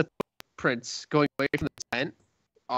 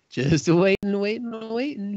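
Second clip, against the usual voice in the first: climbing higher, stretched repetitions; 7 semitones higher than usual. Acted. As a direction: drag out the endless wait. Storyteller dramatizes a long wait.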